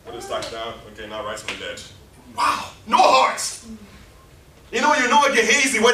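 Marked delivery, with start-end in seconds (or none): 0.0-1.8 half-hearted compliance. Acted. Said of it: "Gehazi was just compliant". 2.4-3.4 loud exclamation. Real, not acted. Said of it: "preacher's exclamation".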